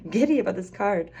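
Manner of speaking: too thrilled to get the words out; giddy laugh. Real, not acted. Reader gushing to pile three about her favorite card, giddy and laughing. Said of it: "I can't even talk 'cause I'm just so".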